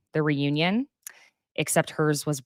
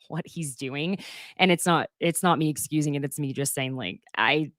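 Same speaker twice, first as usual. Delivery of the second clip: usual speaking voice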